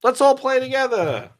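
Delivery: high, put-on naive voice; mock Microsoft. Company asking fighting rivals to play together, high mock-naive voice. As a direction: plead high like a hopeful tag-along. Mocking Microsoft wanting to join in.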